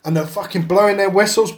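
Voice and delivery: ordinary delivery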